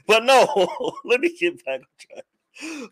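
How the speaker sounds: talking through laughter; real laughing. Real, not acted. Host trying to get back on track, laughing. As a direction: try to carry on while laughing. Laughs at chat, tries to resume.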